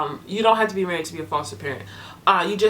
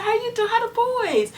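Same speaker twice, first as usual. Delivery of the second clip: bright high greeting. Retold or imitated. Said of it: "I said".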